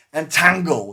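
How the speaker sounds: loud, high casting cry; shouted spell call. Retold or imitated. Mage hitting a raging fighter, shouted spell. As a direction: fire the spell out forcefully.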